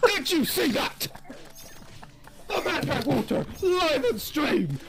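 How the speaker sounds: high hyped-up yelling; mock-amazed hype man; hyped shouting. Mock hype over drinking water.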